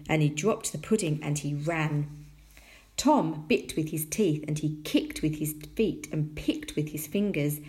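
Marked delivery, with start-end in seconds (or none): none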